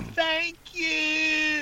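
high, drawn-out thank-you; mock grateful yell. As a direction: call it out high, stretching the vowels. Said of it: "Steve will probably be yelling".

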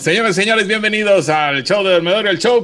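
loud, high emcee welcome; show announcer. Acted. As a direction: hype up the crowd like a showman. Opens the show as host.

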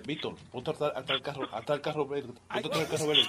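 susurrando a un cómplice ficticio, muy bajo; hushed aside. Acted. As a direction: baja la voz para que no oiga. Bromista habla aparte en voz baja. (voz baja, casi en secreto)

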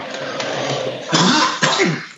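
long raspy throat clear. Real, not acted. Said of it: "Total death".

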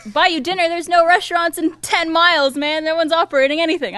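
put-on character voice. Kevin Hart answering the scorpion's dinner demand, high put-on protest. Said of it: "why he's talking like that either".

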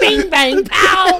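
high, loud, laughing gangster bluster; laughing Pesci burst. Real, not acted. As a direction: throw out the Joe Pesci line, laughing. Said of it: "I fucking committed".

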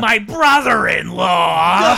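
loud, high, drawn-out mock villain cry; cartoon villain outburst. Co-host mimics Lord Zed meeting Rito, hammed-up shout. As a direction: bellow it like a hammy monster, stretching every word.

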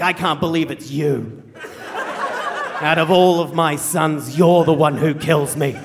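slow, drawn-out disgusted accusation; acted dying dad's slow, scornful rebuke; bitter tirade. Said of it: "Dad continues this tirade of abuse".

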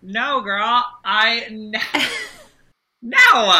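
a high, loud burst of joy, laughing; excited squeal. Real, not acted.

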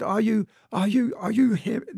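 put-on awestruck little boy. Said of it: "He said".

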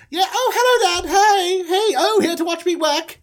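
high, giggly greeting; delighted show-off. Acted. Son spots Dad here to watch him work, high and delighted. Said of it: "And he goes".

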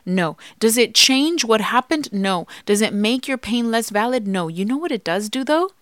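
usual speaking voice

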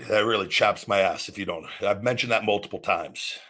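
ordinary delivery

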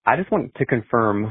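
ordinary delivery

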